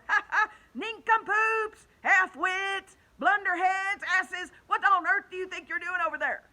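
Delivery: very high, loud taunting shout; yelling insults. Acted. Centipede jeering at the cloudmen, loud high yell. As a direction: holler across the sky. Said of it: "he yelled".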